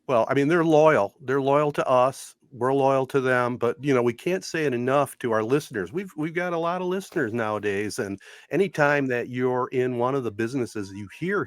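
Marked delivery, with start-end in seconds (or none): none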